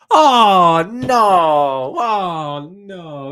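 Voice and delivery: loud, high mock-despairing "oh no"; anguished wail. Real, not acted. Fan wails that his chant no longer fits, loud and high. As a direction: wail as if tragedy struck. The song is ruined.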